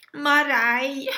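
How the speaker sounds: put-on the girl tells the witches her name, whining; wailing girl. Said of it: "jammerte das Mädchen".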